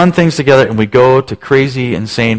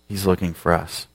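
ordinary talk, nothing marked

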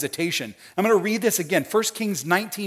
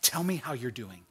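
soft, lowered, caring invitation; tender God; 4 semitones lower, 8 dB quieter than usual. Acted. Tender God looking at Elijah in the cave, gentle. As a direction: gently invite him to open up. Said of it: "read this as tender God".

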